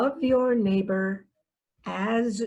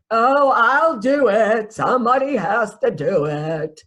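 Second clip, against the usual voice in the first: slow, louder self-sacrificing martyr; martyr sigh; 3 semitones higher, 6 dB louder, slower than usual. Retold or imitated. Over-giver volunteering from the cross, louder and slow mock martyr. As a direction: play the long-suffering martyr, drawn out. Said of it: "crawling up on the cross saying".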